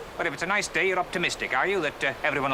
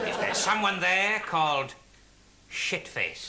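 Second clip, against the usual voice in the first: entranced medium; slower than usual. Acted. Medium calls to the spirits by name, trance voice. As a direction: summon a spirit with measured slowness. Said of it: "he's in a trance".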